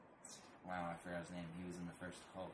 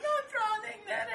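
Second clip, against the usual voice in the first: very high, loud, drawn-out objection; mock shrieked protest; 10 dB louder than usual. Mimicking her scolding him for throwing things, high mock shriek. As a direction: squeal a protest through laughter.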